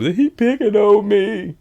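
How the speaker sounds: high, slow put-on whine; whiny complaint. Husband calling about the little boy, high slow whine. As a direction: whine it like a big man tattling. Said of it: "Said he'll call".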